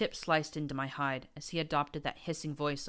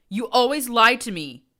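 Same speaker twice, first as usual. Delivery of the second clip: furious scream; 8 semitones higher, 14 dB louder than usual. A narrator's reading. Prince stabbing, raging.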